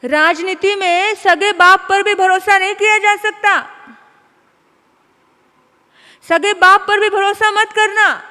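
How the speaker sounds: booming preacher's warning; forceful preaching. Acted. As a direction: drive the lesson home.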